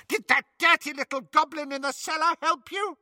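high, shrill witch screech; screaming witch. Acted. Bellatrix screams at Hermione about her vault, high witch screech. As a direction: screech the question like a mad witch.

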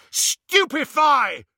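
slow, high, loud spell cry; yelled spell. Acted. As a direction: fire the spell with a yell. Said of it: "and yelled".

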